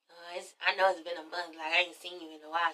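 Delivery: soft low-voiced murmur; hushed voice. [name] murmuring an apology to Kenya, hushed and soft. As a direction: keep it down, barely voiced.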